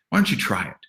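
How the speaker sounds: ordinary talk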